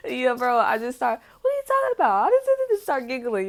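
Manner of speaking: high, loud, laughing through it; words carried on loud giggles; giggling. Real, not acted. Giggling while retelling.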